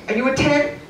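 normal talking voice

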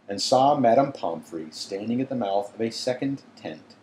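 plain reading voice

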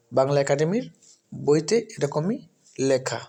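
usual speaking voice

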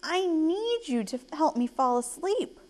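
high-pitched pleading; earnest storybook plea; begging. Acted. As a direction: beg for help, in a child's voice. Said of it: "begged Ava".

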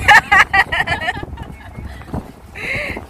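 high giggling laugh. Real, not acted. Laughing in game vehicle.

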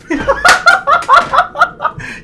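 squealing shocked laugh; high elated laugh. Real, not acted. Laughs at jackpot.

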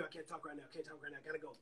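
hushed, hurried brush-off on the phone; rushed brush-off. Retold or imitated. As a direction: get them off the line fast, barely audible. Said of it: "he rushed me off the phone".

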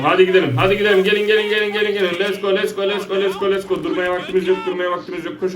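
high-pitched, repetitive hurrying chant; urgent rallying. Real, not acted. Urging teammates to run. (telaşlı, tiz sesle acele ettirme)

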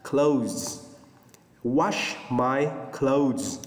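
Wash my clothes very slowly, clothes ending in a Z sound; native pronunciation. Shown as a demonstration. Teacher drills class on saying clothes with Z. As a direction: drag out Wash my clothes, end clothes on a Z. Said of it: "người bản xứ người ta phải đọc là".

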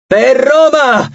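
high, drawn-out war cry; hoarse, stretched shout; hoarse battle cry. A narrator's reading. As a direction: cry it out to rally the troops. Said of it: "gridò Reina con la voce roca".

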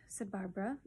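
plain reading voice

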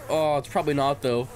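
usual speaking voice

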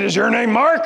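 high, loud gruff demand; gruff preacher questioning a young pastor; gruff old preacher. Retold or imitated. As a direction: question him like a stern elder. Gruff preacher voiced in story.

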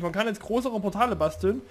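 ordinary delivery

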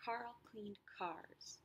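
slow whisper, "Carl cleaned cars"; whispered demo. Shown as a demonstration. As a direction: whisper it slowly, stressing each K. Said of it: "is repeated".

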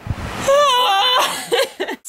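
high, long wailing scream; loud yell. Real, not acted. Sleepless crew member on a night shoot, high wailing yell. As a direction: let out an overtired wail. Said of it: "Who's yelling?".

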